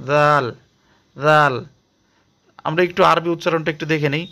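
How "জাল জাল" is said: জাল said with the tongue slightly out; soft tongue-tip জাল; soft Arabic dhal. Shown as a demonstration. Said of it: "জিহ্বাটা একটু বাইরে দিব".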